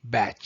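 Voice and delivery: Bach said as "batch", set apart after a pause; correct English pronunciation. Shown as a demonstration. Narrator passing on how colleagues were told, Bach pronounced like "batch". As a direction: say Bach to rhyme with catch, ending in ch. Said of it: "his name should be pronounced as".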